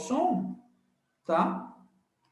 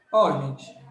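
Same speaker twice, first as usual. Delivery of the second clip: ordinary talk, nothing marked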